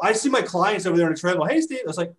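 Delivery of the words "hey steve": higher-pitched call to get attention; calling out to client. Retold or imitated. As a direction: call over to someone you've caught slacking. Trainer calling to client in anecdote.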